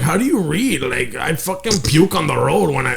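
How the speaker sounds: mock the sister's whiny complaint; imitating sister. Brother mimics his carsick sister's complaint. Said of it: "exactly how she talks".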